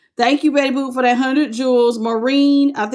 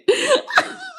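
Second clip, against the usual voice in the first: real laugh. Real, not acted. Streamer tickled by deleted super chat, high laugh.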